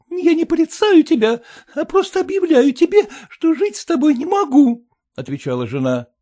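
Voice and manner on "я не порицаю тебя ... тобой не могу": high, loud, tearful wife; aggrieved young wife voice, much higher. Acted. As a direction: complain like an upset young bride. Wife's voice.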